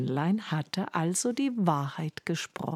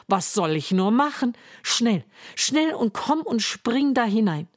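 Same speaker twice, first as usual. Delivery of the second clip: alarmed cry; 6 semitones higher, 4 dB louder than usual. Acted. Giant's wife hearing her husband come, alarmed cry. Wife hurries Hans into oven.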